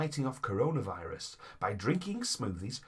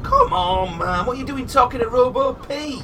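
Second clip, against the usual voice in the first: yelling across the room; 12 semitones higher, 10 dB louder than usual. Acted. Shouting at mate across room.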